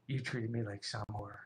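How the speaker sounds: mock-grave, low and very soft; quietly wounded reproach, imitating her; hushed accusation. Woman on the phone accusing her date, hushed mock-grave.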